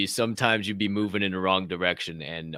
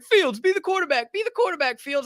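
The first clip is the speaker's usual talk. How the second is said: very high, loud kid imitation; pleading young gamers; 4 dB louder than usual. Kids begging him to play quarterback, high excited pestering. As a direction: pester the player in a mock kid voice. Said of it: "I come in as Justin Fields".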